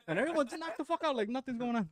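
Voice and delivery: ordinary talk, nothing marked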